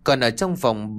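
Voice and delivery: ordinary delivery, nothing marked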